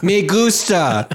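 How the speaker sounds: high, drawn-out Spanish through laughter; goofy high-pitched attempt at Spanish; laughing mock tourist. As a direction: blurt it out slowly, laughing. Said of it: "And you're like".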